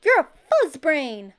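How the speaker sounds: high, loud angry insults; loud childish name-calling; trading insults. A narrator's reading. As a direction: shout names at each other. Shouted insults back and forth.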